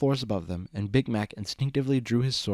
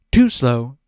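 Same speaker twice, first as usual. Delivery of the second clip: impatient snap; 2 semitones higher, 10 dB louder than usual. Acted. Discord cuts Big Mac off.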